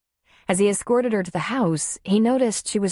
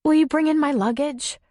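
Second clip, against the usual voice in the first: pitched up and indignant; hurt, defensive woman, higher; 9 semitones higher than usual. A narrator's reading. Darla pushing back at Tom, indignant and high. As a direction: push back as if insulted.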